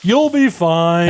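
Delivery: slow, drawn-out dentist brush-off; mock reassuring dentist. Brother voicing a dentist to his patient, drawn-out reassurance. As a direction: stretch it out like an unbothered dentist.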